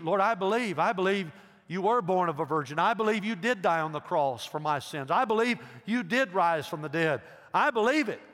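loud, high, emphatic confession; fervent declaration. Acted. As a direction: proclaim belief at full preaching force. Models fervent confession of faith.

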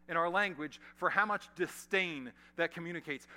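ordinary delivery

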